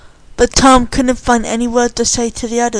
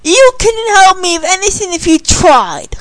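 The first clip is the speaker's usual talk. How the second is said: hollered; 8 semitones higher, 4 dB louder than usual. A narrator's reading. Tom rejecting Candy's help, hollered. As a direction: holler it back, slowing on the last words. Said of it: "he hollered back".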